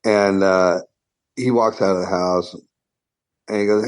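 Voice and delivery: ordinary talk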